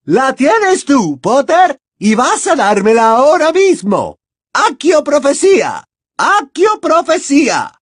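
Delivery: very high, loud shrieking with terror behind it; Bellatrix enraged. A narrator's reading. Bellatrix demanding the prophecy from Potter, screaming. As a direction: scream demands and spells in desperate rage. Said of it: "el terror detrás de la rabia".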